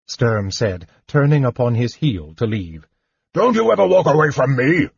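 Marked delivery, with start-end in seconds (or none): 3.3-4.9 higher, louder, fast angry outburst; furious cry. A narrator's reading. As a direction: shout it at his back as he leaves. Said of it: "Derek cried".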